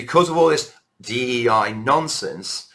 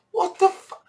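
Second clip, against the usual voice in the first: sudden much higher cry of disbelief; shocked outburst. Real, not acted. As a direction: react in stunned outrage. Outraged at child's question.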